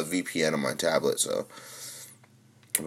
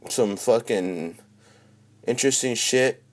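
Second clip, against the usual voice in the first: ordinary talk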